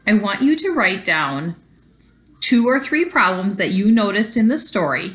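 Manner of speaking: ordinary talk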